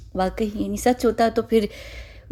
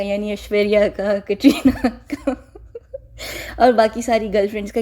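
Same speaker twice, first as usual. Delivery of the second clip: amused, laughing. Real, not acted. Commentator joking about the man's girlfriends as snakes, laughing.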